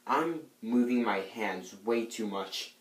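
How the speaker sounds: normal talking voice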